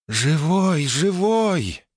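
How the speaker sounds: whispering spirits. Acted. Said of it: "шептали духи-озорники".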